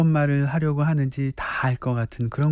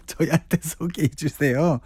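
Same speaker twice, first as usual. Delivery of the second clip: ordinary talk, nothing marked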